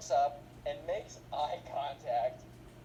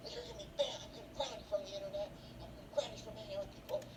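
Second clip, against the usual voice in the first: high, fast scolding with laughter in it; hammed-up scolding mom; 7 semitones higher, 4 dB quieter than usual. Mom threatens to ground Ben, high hammed-up scolding. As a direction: rattle off threats in a pitched-up voice.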